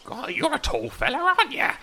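put-on high, thin voice of a fussy old gnome; a sly old gnome sizing up a tall stranger.

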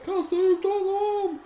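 very high, drawn-out call; thin falsetto mock crowd yell; overheard mob cry. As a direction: wail it out slowly, high up. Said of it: "han har det här ropet".

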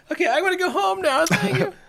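very high, laughing giddy retreat; giddy, laughing overwhelm; flustered excitement. Retold or imitated. Retold flustered exit after praise.

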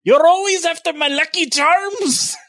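high, loud shouted Irish mascot accent. Shown as a demonstration. Taking his turn, a high shouted hammed-up Irish accent. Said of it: "you do it".

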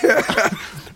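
high burst of laughter; helpless laugh. Real, not acted. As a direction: crack up while struggling with the heat. Laughs at struggling with question.

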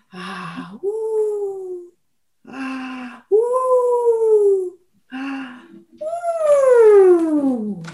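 eerie high wail; wailing monster sound. A narrator's reading. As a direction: moan it high and spooky. The horrible sound approaching.

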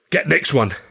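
usual speaking voice

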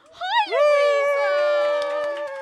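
loud, high shouted hello; excited greeting. Real, not acted. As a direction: call out a big cheer of welcome. Greeting a letter writer in audience.

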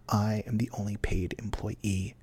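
soft confiding whisper. Real, not acted. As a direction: whisper it like a secret. Confiding aside to listeners.

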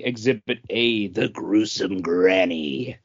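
low, slow mock-creepy announcer; spooky title voice. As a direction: drop into a hammed-up horror voice for the title.

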